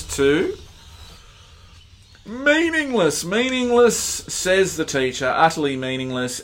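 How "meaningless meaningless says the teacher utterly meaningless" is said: high, loud, grand royal proclamation; Solomon proclaiming. Acted. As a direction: declare it like a king on a stage. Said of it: "start speaking like Solomon".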